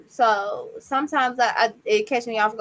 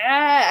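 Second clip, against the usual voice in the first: put-on child's frustrated cry; 4 semitones higher, 6 dB louder than usual. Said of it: "When he gets worked up and be like".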